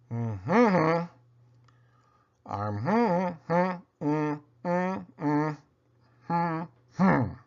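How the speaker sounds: hummed through closed lips, words lost; mouth glued shut. Acted. Boy with glued mouth tries to say good morning, humming through sealed lips. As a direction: mumble as if your lips were glued together. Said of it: "had his mouth glued".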